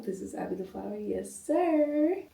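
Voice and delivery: squeaky high yes sir; high playful. Real, not acted.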